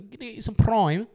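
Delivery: high, loud mock Scottish accent. Joking with cohost in a loud mock Scottish accent. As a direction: belt it out like a hammed-up Scotsman. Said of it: "No, that's Scottish".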